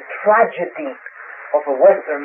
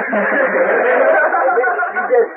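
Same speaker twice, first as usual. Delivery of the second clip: laughing; 5 semitones higher, much slower than usual. Real, not acted. Laughing while telling the story.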